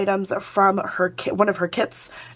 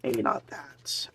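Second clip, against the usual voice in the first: whispering; 11 dB quieter, slower than usual. Real, not acted. Unvoiced muttered self-talk.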